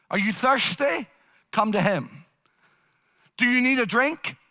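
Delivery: ordinary talk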